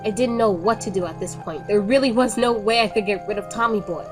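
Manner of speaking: plain reading voice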